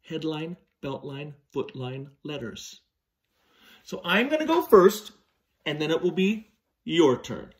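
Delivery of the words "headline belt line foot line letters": soft slow list. Shown as a demonstration. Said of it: "make your most perfect".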